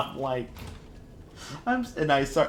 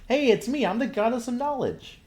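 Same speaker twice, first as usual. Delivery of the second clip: much higher and louder, playful goddess voice; mock goddess; 4 dB louder than usual. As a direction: squeak it like a silly goddess.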